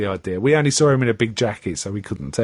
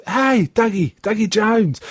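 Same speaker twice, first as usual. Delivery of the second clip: higher, hearty greeting; Bill Shaker greeting; 8 semitones higher than usual. Retold or imitated. Bill Shaker greeting Dougie, hearty and raised. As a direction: hail an old pal with a raised voice. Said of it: "He says".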